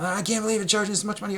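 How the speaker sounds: hammed-up outrage at the price; mock tantrum over a pricey burger; mock indignant complaint. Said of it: "And he was having a fit".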